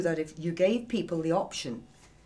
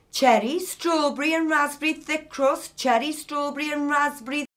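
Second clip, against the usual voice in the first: louder, much higher, announcing the label; reading out loud; 8 semitones higher, 8 dB louder than usual. A narrator's reading. Nicky reading Ollie's bin wrappers aloud, loud and high. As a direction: call out the label like an accusation.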